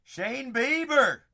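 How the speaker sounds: loud, slow, drawn-out name call; hyped slow name call; card call. Real, not acted. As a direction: announce the pull. Announcing a pulled card.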